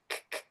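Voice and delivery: repeated voiceless K with no vowel; bare K sound. Shown as a demonstration. Teacher isolating the final sound, bare K. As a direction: click out just the K consonant. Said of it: "termina no K".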